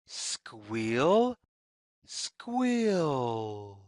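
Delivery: ordinary delivery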